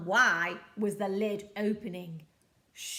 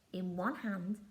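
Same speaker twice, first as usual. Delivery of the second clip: ordinary delivery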